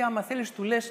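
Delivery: ordinary delivery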